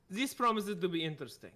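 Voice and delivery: normal talking voice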